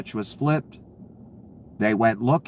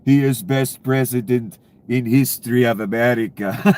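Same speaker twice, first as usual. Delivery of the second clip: put-on louder, heavy Russian accent, like a movie villain; 4 dB louder than usual. Mocking a Russian troll's English in a heavy Russian accent. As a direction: read the fan post as a thick-accented Russian troll. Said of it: "sounds like Ivan Drago in fucking Rocky Four".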